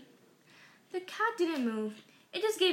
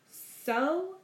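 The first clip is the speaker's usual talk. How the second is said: a short, louder snap of a word; snapped; 3 semitones higher, 4 dB louder than usual. Acted. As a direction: cut him off with an irritated snap. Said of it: "snapped Missus Dursley".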